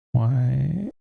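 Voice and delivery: sudden high, baffled question; real confusion at the missing sound; puzzled why. Real, not acted.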